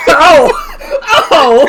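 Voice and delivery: loud high laughing oh; laughing shout. Real, not acted. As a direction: burst out laughing. Realizing the joke.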